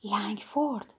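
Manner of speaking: a name breathed out in shock; astonished, hardly audible; stunned near-whisper. A narrator's reading.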